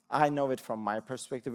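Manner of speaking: ordinary talk, nothing marked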